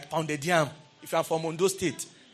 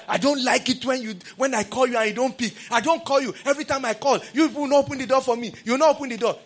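raised, scolding complaint to the wife; mock shouting at wife; 6 semitones higher than usual. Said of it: "You are shouting at your wife".

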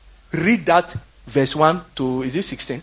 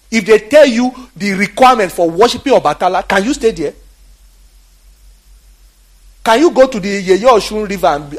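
fiery preaching; 6 semitones higher, 7 dB louder than usual. Real, not acted. Preaching climax.

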